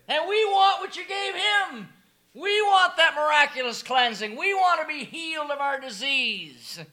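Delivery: voicing the lepers. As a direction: speak up as the ten lepers. Lepers speak as group.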